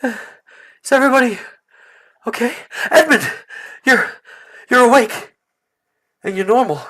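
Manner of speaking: loud, high, halting amazement; startled concern. Acted. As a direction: call out to check on everyone, astonished. Nate checks on friends after waking.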